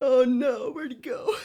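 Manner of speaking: high voice, fading into giggles; laughing dismay. Real, not acted. Creator losing the prop mid-take, laughing. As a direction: lament through real laughter.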